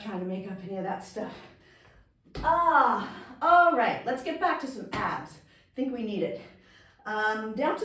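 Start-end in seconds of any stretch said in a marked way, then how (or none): 2.4-5.4 a loud, pumped-up call to get going again; excited rally. Real, not acted. Instructor rallying class back to abs.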